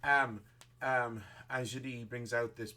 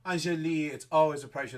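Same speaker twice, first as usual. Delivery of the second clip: put-on louder, warm-toned welcome of an old friend; gracious greeting; 5 semitones higher, 9 dB louder than usual. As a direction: greet her grandly and loudly. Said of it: "She goes".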